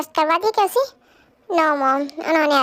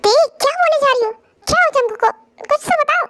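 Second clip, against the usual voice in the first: panicked shout; 9 semitones higher than usual. Acted. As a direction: demand answers in rising panic. Mother panics hearing news.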